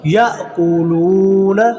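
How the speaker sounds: याअकूलूना recited slowly, loo held long; lengthened lam. Shown as a demonstration. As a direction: catch after ya in याअकूलूना, then stretch loo. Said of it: "अगले लाम को लंबा करना". (याअकूलूना में 'लू' लंबा खींचें)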